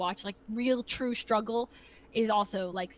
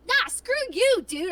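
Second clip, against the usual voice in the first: high, loud cocky comeback; mock defiance; 10 semitones higher, 6 dB louder, slower than usual. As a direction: act bold, brushing the teammate off. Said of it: "I feel way more like".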